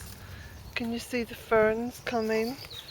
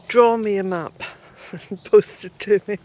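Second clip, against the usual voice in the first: laughing; 8 dB louder than usual. Real, not acted. Joking with viewers.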